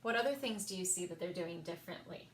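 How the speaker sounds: usual speaking voice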